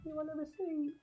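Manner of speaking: put-on very high, soft old-lady voice; little old lady. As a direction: squeak it softly like a tiny old lady. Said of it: "little old lady behind the machine".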